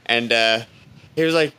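usual speaking voice

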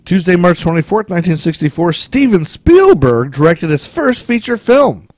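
excited. Real, not acted. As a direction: pitch it up and push it out, eager. Excited trivia reading, raised voice.

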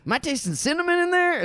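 high, hammed-up inquisitive food host; Guy Fieri. Food host picking out one specific ingredient, high mock wonder. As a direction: ask with mock amazement, pitch way up. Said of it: "he'll get, like, really specific".

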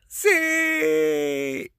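long, sustained character shout; grito narrado. A narrator's reading. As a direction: shout it out and hold the vowel. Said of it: "Y Floyd grita". (grito alargado de personaje)

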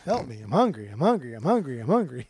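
whiny hungry pet voice; begging pet, over and over; talking animal. Pretend. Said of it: "That's all they would say".

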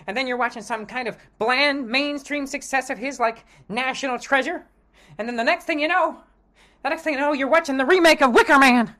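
put-on high, eager gee-whiz boy; wholesome 1950s kid. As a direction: innocent golly-gee schoolboy voice. Kid warns about Cage movies.

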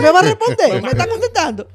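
high, loud, fast outraged shout; mock furious mom. Mother outraged her child answers back, high fast shout. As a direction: explode like a mother hearing backtalk. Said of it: "te decían".

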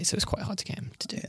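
a sly whisper behind Chris's back; whispered aside. Real, not acted. Host teasing Chris to the others, in a whisper. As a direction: drop to a whisper to tease him.

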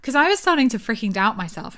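ordinary talk

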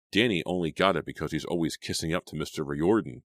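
ordinary delivery

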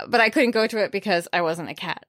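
normal talking voice

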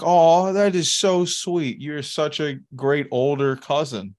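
slow, high, sugary mock gush; gushing clerk. Clerk praising him as a great cousin, slow high gushing. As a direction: ham up the clerk's sweet praise. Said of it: "she was like".

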